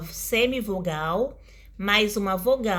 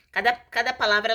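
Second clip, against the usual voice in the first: usual speaking voice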